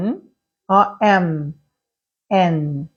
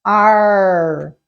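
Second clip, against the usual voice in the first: strong rolled caipira R on Ar; caipira accent. Shown as a demonstration. Teacher demoing a caipira R for students. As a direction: draw out Ar with a thick countryside R. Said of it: "o mais caipira que você puder".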